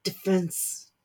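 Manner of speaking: sullen, muttered single word. Acted. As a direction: say it grudgingly, sulking. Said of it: "The word was sullen as he said it".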